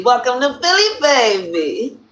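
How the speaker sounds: hyped catchphrase, lifting high on Philly; hyped welcome. Acted. Greeting Uncle L from Philly, hyped. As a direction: call out a playful hometown welcome.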